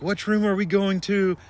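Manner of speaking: high, loud panicked question; frantic shouted question; freaking out. Retold or imitated. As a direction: call out in a panic while pushing the bed. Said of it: "freaking out".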